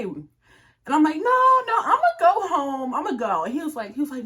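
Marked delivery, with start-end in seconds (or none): 1.2-3.4 high, loud retold protest; emphatic refusal. Telling him she's going home, loud refusal. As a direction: push back loudly, pitched up.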